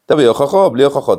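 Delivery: mock yell demanding proof; shouted demand. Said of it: "ככה הוא צועק על רבי".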